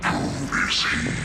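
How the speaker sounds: slow, unearthly booming whisper from a computer; thunderous demonic voice. A narrator's reading. Presence speaks through the computer, slow and demonic. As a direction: drag it out like a monstrous presence. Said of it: "voice is like a thunder crash".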